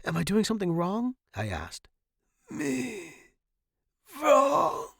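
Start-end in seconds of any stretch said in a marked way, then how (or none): 0.0-1.2 quick, high, worried question. A narrator's reading. Student to choking professor, worried. 2.5-4.9 high, strained dying rasp; choked croak. A narrator's reading. Dying professor to student, choked. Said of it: "he choked out".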